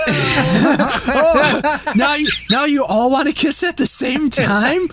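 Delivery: high puppet voice breaking into laughter; hand-puppet voice. Pretend. Puppets fussing over kisses, high laughing puppet voice. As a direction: tease like a puppet, high and giggly. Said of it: "He's gonna have two hand puppets".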